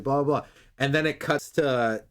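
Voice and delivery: usual speaking voice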